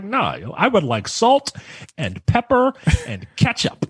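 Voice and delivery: put-on Baltimore accent rising high on ketchup. Ordering salt, pepper and ketchup in a hammed-up Baltimore accent. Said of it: "it don't work like that in that accent".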